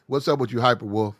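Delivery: normal talking voice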